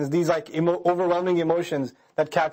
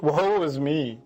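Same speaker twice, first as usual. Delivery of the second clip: mock woe, pitched lower; sarcastic lament; 4 semitones lower, much slower than usual. Student hearing of a hard exam, sarcastic lament. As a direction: play at despair without meaning it. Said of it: "That's sarcasm. That's not serious".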